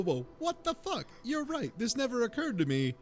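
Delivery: normal talking voice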